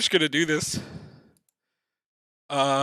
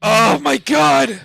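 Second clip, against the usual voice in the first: exasperated; 5 semitones higher, 3 dB louder, slower than usual. Real, not acted. Reacting to bad flip.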